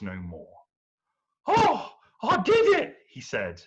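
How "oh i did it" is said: very high, loud delighted cry; surprised at his own win; triumphant hero. Acted. Perseus after killing the fish creature, high triumphant squeal.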